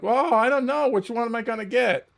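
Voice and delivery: lightly voiced ponderer choosing an inhaler; dithering nurse. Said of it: "he's, like, stroking his chin, like".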